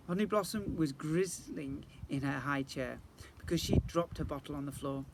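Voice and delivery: ordinary delivery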